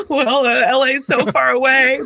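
mock maternal fretting; fretful mom. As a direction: voice mom's fears through a laugh. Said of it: "my mom's darling Mansfield, Ohio fears".

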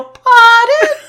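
put-on very high, loud, squeaky little-kid "Pardon?"; mock-cute toddler asking pardon; sweet child's pardon. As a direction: play a tiny polite child asking pardon. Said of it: "trained them all just to go".

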